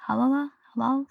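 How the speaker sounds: "Halala? Halal?" as two soft, unsure attempts; butchered pronunciation. Real, not acted. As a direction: fumble "Halala", then retry "Halal", both questioning. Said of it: "I am butchering things so bad".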